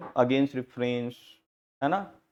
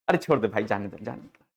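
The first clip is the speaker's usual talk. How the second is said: soothing; 7 semitones lower than usual. Retold or imitated. Separating a fight gently.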